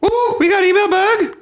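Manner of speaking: shrieking high mock Irish; loud, silly falsetto fake Irish; bad Irish accent. Shown as a demonstration. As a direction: squeal it in hammed-up Irish. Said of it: "inflect up like everything's a question".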